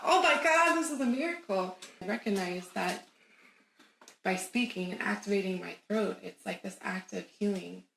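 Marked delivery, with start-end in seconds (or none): 0.0-1.8 high, louder cry of amazement; awestruck. Retold or imitated. Came home crying after the run, awestruck. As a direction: re-enact being floored by a miracle.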